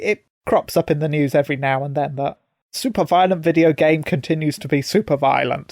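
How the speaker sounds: ordinary talk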